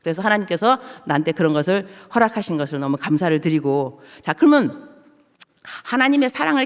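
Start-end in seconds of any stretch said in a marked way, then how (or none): none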